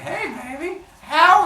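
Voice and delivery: high put-on come-on; hammed-up flirting; flirty greeting. Zeus flirting at the door.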